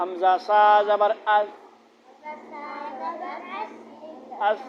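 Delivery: drilled spelling, slowed down; slow spelled out. Shown as a demonstration. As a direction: spell it out slowly for a child. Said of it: "متحرکہ لاؤ".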